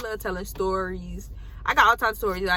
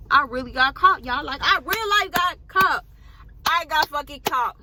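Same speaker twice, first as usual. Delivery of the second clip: excited; 6 semitones higher, 5 dB louder than usual. Real, not acted. Emphatic disbelief at getting caught.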